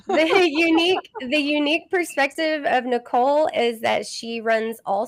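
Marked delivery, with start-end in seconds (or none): 0.1-1.1 put-on Southern accent. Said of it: "bring my Southern accent on".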